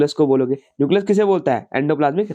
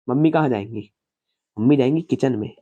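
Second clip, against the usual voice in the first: ordinary talk, nothing marked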